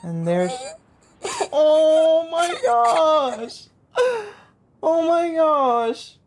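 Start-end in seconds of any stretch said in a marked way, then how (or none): none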